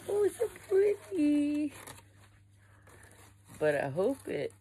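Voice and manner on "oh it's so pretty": high squeal of delight; delighted gush. Real, not acted. Vlogger unboxing a purchase, high delighted squeal. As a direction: gush over the pretty thing. Unboxing, gushing over an item.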